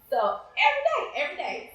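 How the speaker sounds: laughing through the words, high. Real, not acted. Laughing as she tells the host about the staring boy. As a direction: let the laugh break into the line. Laughing at her own story.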